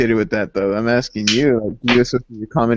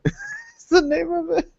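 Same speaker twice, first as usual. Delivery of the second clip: laughing; 5 dB quieter, slower than usual. Real, not acted. Laughing at a silly ad.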